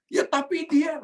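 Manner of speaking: very high, protesting mock whine; defensive excuse. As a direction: start making excuses, pitched right up. Acted excuse after "kenapa marah. (berdalih dengan nada sangat tinggi)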